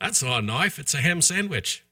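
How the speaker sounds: mock Aussie movie line; punchline in a playful Australian voice; hammed-up Australian bloke; Australian accent. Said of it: "That's pretty good, [name]".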